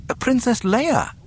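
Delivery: high-pitched fussy droid voice; announcing droid. A narrator's reading. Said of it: "three P O announced".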